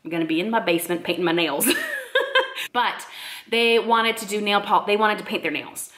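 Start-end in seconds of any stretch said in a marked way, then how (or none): 1.7-2.7 a high giggle trailing off; high laugh. Real, not acted. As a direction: laugh at her own excuse. Laughs at painting nails instead.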